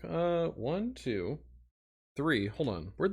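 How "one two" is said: normal talking voice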